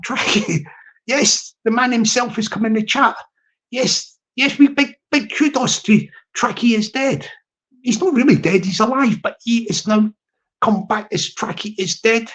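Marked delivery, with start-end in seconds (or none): none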